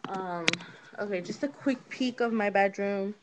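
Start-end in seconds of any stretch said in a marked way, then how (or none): none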